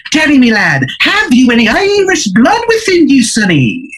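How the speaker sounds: very high bad Irish brogue, dropping at the end; Izzy Irish. Leprechaun-dressed man raises a glass to a drinker, bad Irish accent. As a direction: ham up a terrible Irish accent. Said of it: "his accent is horrendous".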